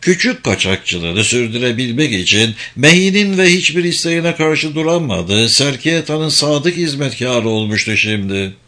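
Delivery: ordinary delivery, nothing marked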